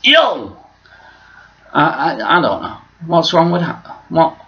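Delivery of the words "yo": higher, louder hip greeting; a brash "Yo!"; mock street yo. Shown as a demonstration. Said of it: "if I wanna go".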